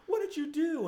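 hammed-up accusing girlfriend voice. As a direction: do the girlfriend catching him out. Girlfriend voiced in dream story.

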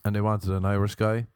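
ordinary talk, nothing marked

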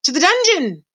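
high-pitched crowd cry; high condemning call. A narrator's reading. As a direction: call for punishment. Crowd mouse demands punishment.

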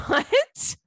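high-pitched incredulous squeal; a drawn-up, disbelieving exclamation; astonished. Acted. Reacting to her date's rock band fandom, astonished.

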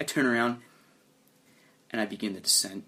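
usual speaking voice

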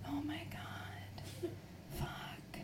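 slow, barely audible whisper; dreading whisper. Retold or imitated. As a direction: whisper it privately, in acted dread. Retold private dread at his request.

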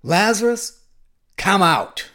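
big, commanding shout, name pitched high; loud call. Acted. Jesus calls the dead Lazarus from the tomb, loud command. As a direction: call out powerfully, pausing after the name. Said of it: "called out in a loud voice".

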